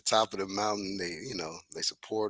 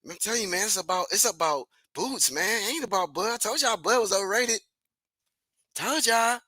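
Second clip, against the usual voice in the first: lightly higher mocking fanboy voice; 9 semitones higher than usual. Mocking rival fanboys who bragged too early, in a lightly higher voice. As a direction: parody a smug fan boasting.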